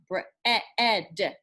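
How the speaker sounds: "bre-, e-, ed" broken into separate sound chunks; sounding out bread. Shown as a demonstration. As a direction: segment "bre-, e-, ed" to show the short E. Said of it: "that is what guides that digraph sound".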